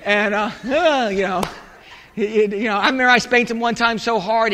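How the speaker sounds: ordinary delivery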